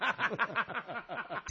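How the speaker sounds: a short soft real laugh. Real, not acted. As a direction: let a quiet laugh slip out. Narrator laughs at the clip. (risa breve y suave)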